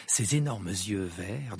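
ordinary delivery, nothing marked

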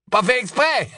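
high sneering question; mock him; high scornful echo of Ron's words. Death Eater mocks Ron.

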